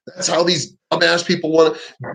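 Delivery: ordinary delivery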